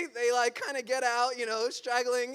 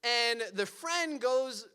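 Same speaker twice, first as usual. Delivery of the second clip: usual speaking voice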